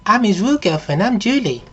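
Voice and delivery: put-on high-pitched girlfriend doll; high mock-female voice; doll come to life. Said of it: "it turns around and it says".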